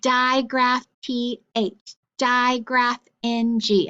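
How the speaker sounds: digraph T H said slowly letter by letter; slow clear naming. Shown as a demonstration. Teacher naming letter cards slowly for the class, slow and clear. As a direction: spell each digraph out slowly for the kids. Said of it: "saying their full name".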